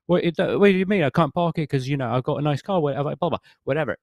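high-pitched mock-Cockney protest; imitated Cockney. Imitating the driver arguing about parking, high mock Cockney. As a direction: put on Cockney, pitched well up. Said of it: "a little bit of Cockney".

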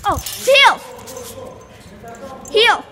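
loud, firm call of heal to the dog; shouted command. Real, not acted. Girl ordering her game dog to heel, loud shout. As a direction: bark the order so the dog obeys.

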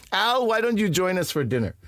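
high, friendly mother voice; loving, gregarious mother. Retold or imitated. Buddy's mother inviting him to dinner, loving high-pitched voice. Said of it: "she was a loving, gregarious woman".

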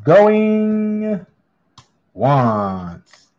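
stretched, sing-song going once, going twice; drawn-out auctioneer call. Acted. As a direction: call it like an auctioneer holding the hammer. Auctioneer calls closing the lot.

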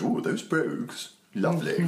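high, slow gushing bouncer. Pretend. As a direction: coo over the shoes in a high voice. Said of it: "This, this is my bouncer voice".

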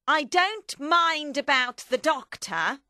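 high, loud, slow and deliberate; Madame Defarge. Acted. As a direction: spell out your position forcefully. Narrator acts Madame Defarge's speech.

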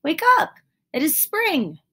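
very high, loud and eager; excited shout. A narrator's reading. Frog shouting to sleepy Toad that spring has come. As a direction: burst out with the good news.

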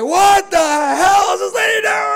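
high, slow, loud yell of road rage; angry driver yelling in traffic; furious shout. Retold or imitated. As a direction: scream it at the driver ahead. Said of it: "and I lost it".

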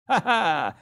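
put-on high, loud boyish laugh; a delighted little-boy "ha, ha" cackle; gleeful laugh. A narrator's reading. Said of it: "laughed little Wosten Walbert Schimmelpenninck".

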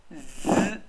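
z said alone as a buzzing, voiced sound. Shown as a demonstration. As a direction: hold z as a voiced buzz, not s. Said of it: "它是念z的声音". (单独念出带振动的 z 音)

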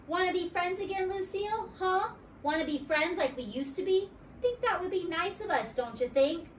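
put-on bright little-girl sweetness, pitched high; wheedling kid voice up high; high girlish Junie B. voice. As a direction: coax her back as a friend.

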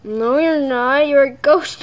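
usual speaking voice

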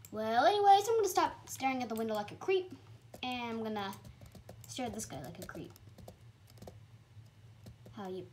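ordinary talk

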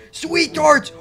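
high delighted cry; excited exclamation. Real, not acted. Host finding the best candy, high excited cry.

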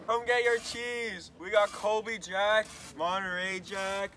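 high, slow, loud vendor call; cheese vendor calling. Acted. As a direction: hawk cheese to passers-by. Vendor calls out wares.

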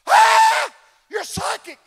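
high, loud shocked shriek; startled flight attendant. Retold or imitated. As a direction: play the startled attendant squealing. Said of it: "She wheeled around and goes".

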